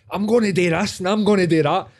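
high-pitched wired boasting; house party big talk. Acted. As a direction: play a hyped-up party rambler. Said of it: "conversation that you have in a house party".

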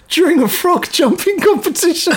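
high, squeaky laughing delivery; words dissolving into giggles; breaking into laughter. Real, not acted. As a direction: lose it while reading aloud. Corpsing while reading absurd law.

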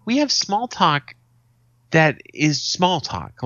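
a hint of a lecturing grandfather; emphatic grandfather. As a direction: spell it out slowly like an annoyed old man. Grandfather quoted, explaining.

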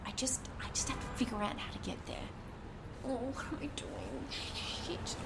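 fast, scared whisper, voice dropping away; frightened whisper. Acted. Scared woman confesses her escape plan in a frightened whisper. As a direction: she loses her nerve and hushes, rushing the words.

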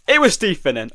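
real, high, loud burst of triumph; excited cheer. Real, not acted. Quiz player learns his guess was right, excited. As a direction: celebrate a surprise correct answer loudly.

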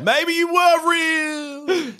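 high, slow, drawn-out wail; mock wail. Player answering the taunt as the wizard, high slow wail.

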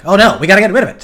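mock panic. Film people spotting trouble in the footage, mock panic. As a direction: play a hurried mock cover-up.

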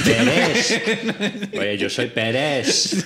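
low, slow, drawn-out Pérez voice; mocking Pérez, slow and low; imitating Pérez. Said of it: "imitando a Pérez".

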